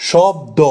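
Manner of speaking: "শব্দ" split, ending on an open o; open final syllable. Shown as a demonstration. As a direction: say shob, then do, in "শব্দ". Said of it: "দ এর পর একটা অ রয়েছে". ("শব্দ" ভেঙে, শেষের অ খোলা রেখে)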